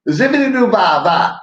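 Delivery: high, loud scat in [name]'s style; high scat opening of the [name] bit; [name] impression. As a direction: scat it like a soul singer. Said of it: "You sound like [name]".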